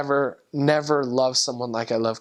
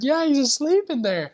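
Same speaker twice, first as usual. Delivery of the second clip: much higher, incredulous little voice; amused insistence; 13 semitones higher than usual. Retold or imitated. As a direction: tease your companion with the news. Insists to companion he's asleep.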